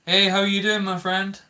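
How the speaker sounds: high, loud cheerful hello; upbeat hi to the audience; bright greeting. Real, not acted. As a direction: greet the viewer warmly and loud.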